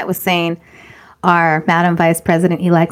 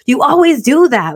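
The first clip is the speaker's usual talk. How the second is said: raised-pitch mock scolding; teacher's reprimand; 6 semitones higher than usual. Preschool teacher to a little girl, mock reprimand. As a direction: scold like a fed-up teacher, lightly. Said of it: "the way that she reprimanded me".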